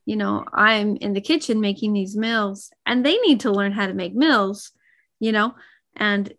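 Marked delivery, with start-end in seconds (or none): none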